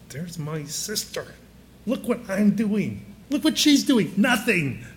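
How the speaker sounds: a sister's huffy grumble, rising on "Look what she's doing"; frustrated Martha. Acted. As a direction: grumble like the sister left with all the work. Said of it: "she is growing more frustrated and upset and actually angry".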